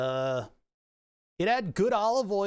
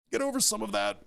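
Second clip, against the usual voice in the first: hiccuping; 7 semitones higher than usual. Real, not acted. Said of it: "Sorry, I'm hiccuping a lot".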